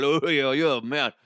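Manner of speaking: badly faked Cajun accent, a bit loud. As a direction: hawk your wares in a clumsy Cajun accent. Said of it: "the guy has a Cajun accent".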